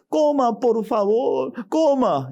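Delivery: high, pleading mother's voice. Acted. Mother pleading with her baby to eat, high and begging.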